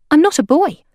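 put-on high, loud young girl; girl's blunt objection. A narrator's reading. Girl forgetting to be bashful, high objection. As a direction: pipe up and correct them.

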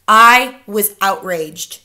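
loud, slow, weighty narration; outraged. A narrator's reading. Child outraged at grandmother's claim, emphatic. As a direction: stress each word with affronted force.

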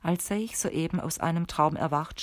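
ordinary delivery

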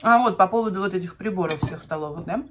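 chewing while talking; speaking around food; mouth full. Real, not acted. Seen 0: Woman bites food at -2; closed, working lips while speaking after.

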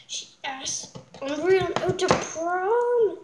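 loud jealous outburst; mad. Acted. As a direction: spit it out with jealous anger. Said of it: "I'm so mad".

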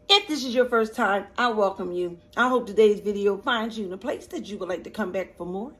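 normal talking voice

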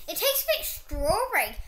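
talking while chewing; chewing candy. Real, not acted. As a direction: mouth partly full of candy. Seen 1: Girl in pink holds red candy, jaw moving while talking.

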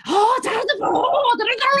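an irritated sat-nav, hammed up; angry GPS voice. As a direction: play the GPS barking directions. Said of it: "they have the G P S and it's like".

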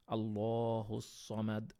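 slow, pausing Quran recitation; recited with a pause. Shown as a demonstration. Teacher recites for students, breaking the verse apart. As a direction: let each phrase stand alone before stopping. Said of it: "বিরতি নিলেন".

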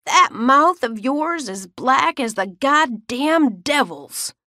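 slow, high, furious shout. Acted. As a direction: bellow it like a furious grandmother. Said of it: "she shouted in that tone".

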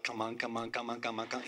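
low, hushed, repeated urging; urgent quiet pleading. Retold or imitated. Preacher silently urging God to speak, hushed pleading. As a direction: plead softly under the voice.

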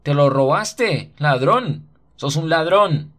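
high, loud, slow taunt of thief; jeering accusation. A narrator's reading. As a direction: shout it like a mocking street kid. Boys jeer and accuse him.

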